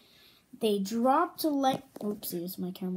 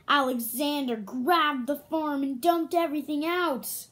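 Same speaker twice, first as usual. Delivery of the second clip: ordinary delivery, nothing marked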